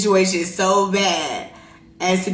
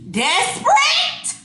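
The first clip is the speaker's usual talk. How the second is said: high, slow, outraged question; offended echo; slower than usual. Retold or imitated. Speaker echoing a friend's insult, high indignant disbelief. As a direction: repeat the insult back in disbelief.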